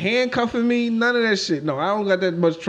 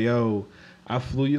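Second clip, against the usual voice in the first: mock cringe line; 8 semitones lower, 5 dB quieter, slower than usual. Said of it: "I'm not about to say".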